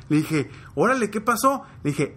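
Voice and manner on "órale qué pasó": surprised dad. Retold or imitated. Father reacts to son's news.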